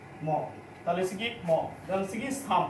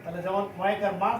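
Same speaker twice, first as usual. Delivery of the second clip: ordinary talk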